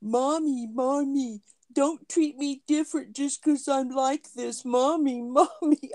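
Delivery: high-pitched mock pleading kid; whiny son act. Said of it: "he kept going".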